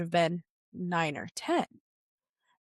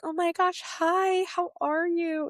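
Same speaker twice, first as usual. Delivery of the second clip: much higher, gushing hello; bubbly doctor greeting; 9 semitones higher, slower than usual. Retold or imitated. OB cheerfully greeting her stunned patient, bubbly high voice. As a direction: chirp a cheery hi like a doctor who doesn't know yet. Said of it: "My O B came up to me and was like".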